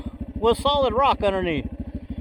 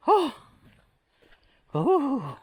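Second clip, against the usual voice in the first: ordinary talk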